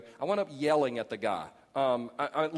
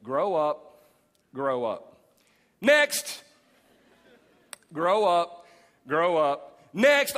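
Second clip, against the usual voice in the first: mock counselor waving couples through; blunt dismissal; 6 dB louder, much slower than usual. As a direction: brush them off like a clerk. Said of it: "look at the husband and wife and go".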